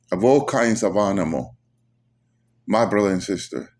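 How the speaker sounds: ordinary delivery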